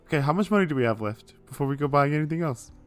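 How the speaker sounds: usual speaking voice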